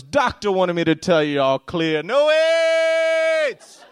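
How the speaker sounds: put-on loud Jamaican accent climbing to a high holler; booming mock Jamaican, last words shrieked high; positive Jamaican woman. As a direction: announce good news in a jubilant Jamaican accent. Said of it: "this positive Jamaican woman".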